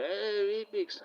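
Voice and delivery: high-pitched mock delight; acted high, pleased cry; excited exclamation. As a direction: call it out like a happy surprise. Acting out people's surprised reaction.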